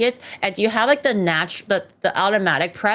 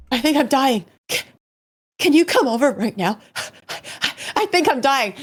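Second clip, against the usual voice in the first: mock dying panic; 4 semitones higher than usual. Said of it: "he was like".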